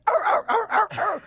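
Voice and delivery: loud, very high rapid barks; yapping dog imitation, repeated barks; frantic dachshund barking. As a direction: bark like a shocked little dog.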